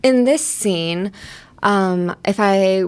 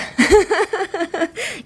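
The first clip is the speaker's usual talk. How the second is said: high bright giggle; a quick embarrassed giggle; 12 semitones higher than usual. Real, not acted. Giggling after saying her own example to viewers. Laughs after my ideal type.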